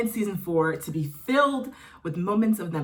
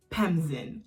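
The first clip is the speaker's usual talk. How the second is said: ordinary talk, nothing marked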